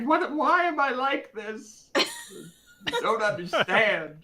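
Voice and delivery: high, slow confused seal voice; slow, high-pitched drunken bewilderment; bewildered drunken seal. Acted. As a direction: wail it like a baffled drunk seal. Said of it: "I feel like it wouldn't understand what was going on".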